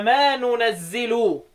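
slow ننزل as "nunazzilu"; n first, "zi" in ننزل, drawn out; nun and kasra in ننزل. Shown as a demonstration. Teacher applies the rule just quoted, slow nunazzilu for ننزل. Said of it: "وبالنون فيها واكسر الزاي".

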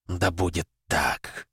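soft, slow rumbling grave voice; sepulchral voice. Acted. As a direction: pronounce it like a doom, hushed and slow. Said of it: "произнесла та". (тихий, медленный замогильный голос)

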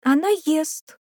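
put-on very high child's exclamation; excited, high-pitched little girl; girl's cry. A narrator's reading. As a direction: burst out with the news in a small girl's voice. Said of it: "крикнула Димфна".

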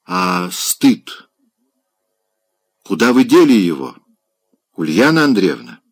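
sharp rebuke. A narrator's reading. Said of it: "сказал он резко".

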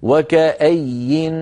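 "وكأي" drawn out very slowly, ending on a clear silent nun; pause on nun. Shown as a demonstration. Teacher to reader: slow "وكأي" ending on a clear nun. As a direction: stretch "وكأي" and close it on an audible nun. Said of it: "تقف كما أمامك بنون".

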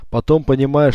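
plain reading voice

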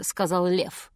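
plain reading voice

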